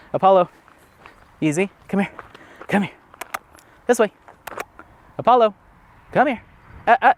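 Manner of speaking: high, loud calls; calling the dog. Real, not acted. Owner calling her dog away from a mud pit, loud and high. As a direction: call him away from the mud.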